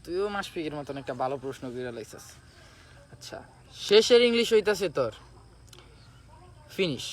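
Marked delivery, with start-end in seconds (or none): none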